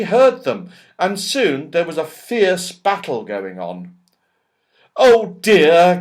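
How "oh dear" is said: loud, high gasp; dismayed girl catching her breath. A narrator's reading. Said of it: "gasped Beth".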